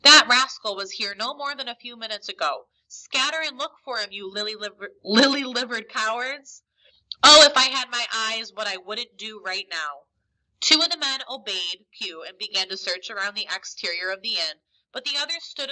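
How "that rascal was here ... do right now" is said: raised-voice urging in bursts; storyteller's mildly desperate villain. A narrator's reading. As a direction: press the men to keep searching. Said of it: "he urged desperately".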